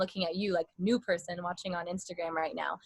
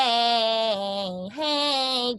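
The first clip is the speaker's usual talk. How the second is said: over the top; 4 dB louder than usual. Shown as a demonstration. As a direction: overdo the "hey" greeting. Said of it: "go over the top".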